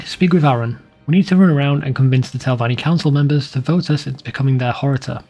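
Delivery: normal talking voice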